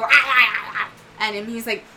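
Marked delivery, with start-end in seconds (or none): 0.0-0.9 louder chomping ah ah; snapping teeth noise. Acted. Toothy creature snapping at the knight, loud chomping cries. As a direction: bark it like snapping jaws.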